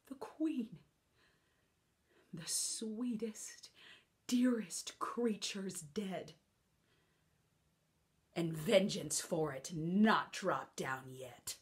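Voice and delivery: slow, broken whisper; hushed whisper of loss; grieving whisper. Acted. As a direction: breathe the news of her death. Whispered grief after the cry.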